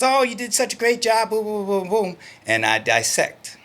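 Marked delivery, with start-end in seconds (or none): 0.0-2.2 high, hammed-up cheerleader voice; mock the empty back-slapping praise; over-the-top mock flattery, much higher; gushing praise. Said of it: "the one that goes".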